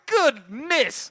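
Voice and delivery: high, awed gasp of a word; stunned goodness. Retold or imitated. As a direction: react in shock to the shooting. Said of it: "I'm like".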